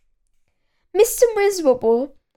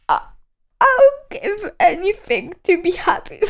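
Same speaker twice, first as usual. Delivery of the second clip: high, weepy and pleading; pleading miserable voice; 7 semitones higher than usual. A narrator's reading. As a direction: beg as if nothing could help.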